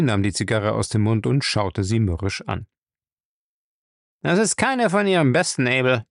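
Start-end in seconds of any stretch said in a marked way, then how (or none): 4.2-6.1 high-pitched mild reproach; reproachful. Acted. Lacey grumbles to Abel about the cigar, reproachful. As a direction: complain as if slighted. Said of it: "sagte er vorwurfsvoll".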